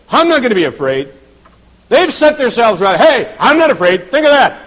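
loud, high bragging challenge; swaggering, shouted boast; boastful. Acted. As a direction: crow it defiantly to everyone around. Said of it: "it better not be in a boastful attitude".

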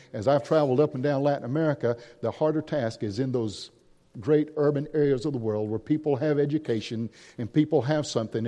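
ordinary delivery

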